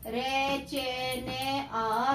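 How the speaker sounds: slow sing-song syllable spelling; sing-song spelling drill; drawn-out dictation. Acted. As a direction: stretch each syllable like a tuition teacher dictating.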